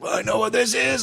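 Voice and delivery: high, slightly dreamy realization; knowing stoned voice. Retold or imitated. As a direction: say it like you've been here before. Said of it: "I was like".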